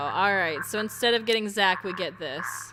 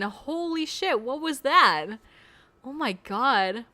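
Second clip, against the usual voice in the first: shocked; 4 semitones higher, 5 dB louder, slower than usual. Real, not acted. Shocked after intense scene.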